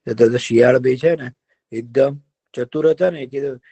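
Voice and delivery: usual speaking voice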